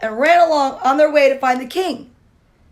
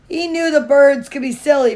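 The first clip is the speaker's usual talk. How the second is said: plain reading voice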